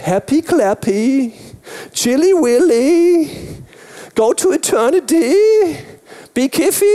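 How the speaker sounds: mocking slogans. Mocking shallow faith slogans.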